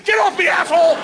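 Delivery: high, loud acted fury; angry yell. Cameraman without parachute kicks comic away, high angry shout. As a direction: yell it while fighting someone off.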